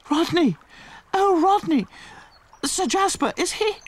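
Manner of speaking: high, hammed-up swooning lady; melodramatic heroine. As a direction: overact the heroine.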